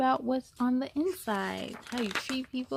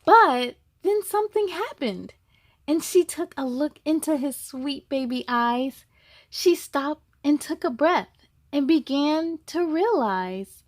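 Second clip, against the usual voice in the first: louder, lively storybook narration; reading to children; 2 semitones higher, 5 dB louder than usual. A narrator's reading. Reading the zebra story to kids, lively and louder. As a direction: tell it brightly to the little ones. Reads the picture book aloud.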